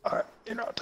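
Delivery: tense whisper. Real, not acted. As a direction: whisper it under pressure. Tense moment in game.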